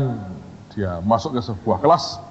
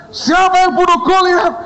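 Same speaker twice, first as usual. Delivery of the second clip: very high, theatrical demanding yell; comic high-pitched outburst; mock angry interrogation; 4 dB louder than usual. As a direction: demand it like an exploding boss, hammed up. Boss repeats accusation.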